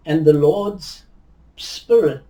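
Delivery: ordinary talk, nothing marked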